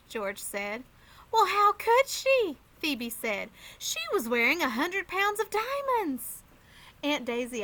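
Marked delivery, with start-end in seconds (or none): 1.3-6.4 put-on high, loud little-girl voice; high and loud, matter-of-fact kid; little sister. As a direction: pipe up for her brother. Phoebe voiced, defending.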